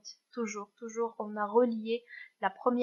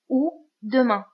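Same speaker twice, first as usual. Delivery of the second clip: ou said loud and falling before demain; stress on ou; 7 dB louder, slower than usual. Shown as a demonstration. Teacher marking the second option, ou hit loud. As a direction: punch ou to mark the second choice. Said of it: "Je vais utiliser ou".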